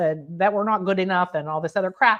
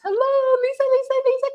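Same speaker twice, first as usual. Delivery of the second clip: much higher, sing-song hello; delighted greeting. Real, not acted. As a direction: call out to a friend in delight. Greeting a viewer joyfully.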